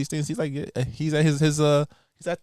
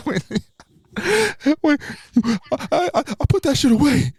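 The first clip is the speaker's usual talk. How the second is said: overplaying a dramatic confession; mock intense movie scene; hammed-up movie line; 13 semitones higher than usual. Acting out a Creed scene.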